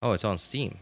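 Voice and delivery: usual speaking voice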